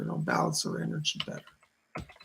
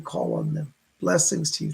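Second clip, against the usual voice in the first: ordinary talk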